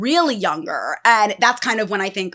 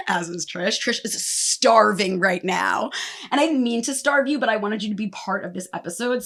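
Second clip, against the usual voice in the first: normal talking voice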